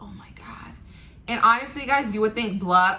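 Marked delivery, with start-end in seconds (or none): 0.0-0.7 whispered, breathy exclamation; barely voiced whisper; hushed awe. Tearful mother beside her daughter in surgery, retold in a whisper.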